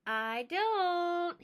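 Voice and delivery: narrow-eyed. Acted. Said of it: "he said, narrowing his eyes".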